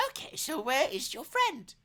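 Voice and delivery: high-pitched granny asking. Acted. Granny asks her guest about his friend, high voice. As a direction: pitch it high like an old lady.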